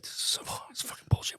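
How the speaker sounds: quick whispered cursing to oneself; retold whispered self-reproach; dreading whisper. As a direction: whisper it fast, stewing in dread. Child dreading punishment all day.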